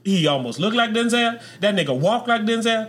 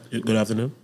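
Denzel voice; 10 semitones lower, 4 dB quieter than usual. Retold or imitated. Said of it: "I was trying to do a Denzel voice".

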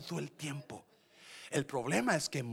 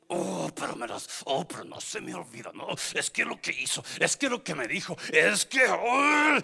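whiny grudge-holder; 3 semitones higher, 7 dB louder than usual. Retold or imitated. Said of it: "usted la sigue alimentando".

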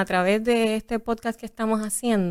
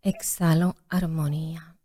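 whispered meditation; 4 semitones lower, 4 dB quieter than usual. Shown as a demonstration. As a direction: whisper the exhale like a yoga guide. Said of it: "en tu voz de maestra de yoga".

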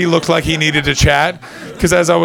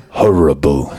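imitated English accent, much lower and judgmental; 12 semitones lower, slower than usual. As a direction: drop low for a mock English verdict. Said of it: "And in your accent, it really fucking hits it home. It's that beautiful English judgmental".

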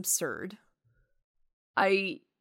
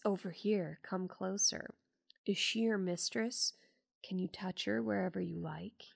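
hushed, softer creepy murmur, lightly voiced; creepy whisper; 10 dB quieter than usual. Retelling the whispering patient luring the fiancé, hushed and creepy. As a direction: lean in and beckon in a hushed, eerie voice. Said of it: "goes towards a whispering character".

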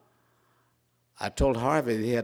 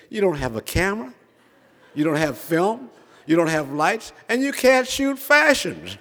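exasperated disbelief; 8 semitones higher, 6 dB louder than usual. Retold or imitated. Friend telling the young man he can't shoot fashions, exasperated. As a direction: pile up objections as an incredulous friend. Friend objects to absurd plan.

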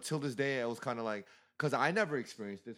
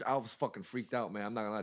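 usual speaking voice

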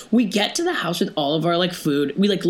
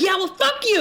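angry retort; 13 semitones higher than usual. Retold or imitated. Acted retort from driver.

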